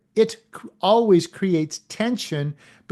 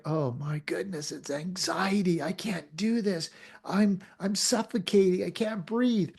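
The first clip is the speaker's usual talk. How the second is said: softer, panicky and breathless; much softer, like panic closing in; anxious; 5 dB quieter than usual. Pretend. Acting out anxiety about change, softer panicky voice. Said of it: "Or is it like".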